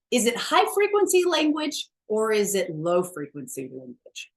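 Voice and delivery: ordinary talk, nothing marked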